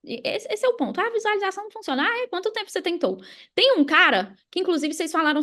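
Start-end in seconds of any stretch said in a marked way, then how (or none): 1.0-2.0 much higher, fast whiny mock complaint; mocking a quitter. Mimicking a skeptic who quit visualizing, mock whine. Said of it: "as pessoas não sustentam". 2.0-3.2 higher, teasing "oh really?" challenge; sarcastic retort. Retold or imitated. Answering the imagined skeptic, sarcastic and higher.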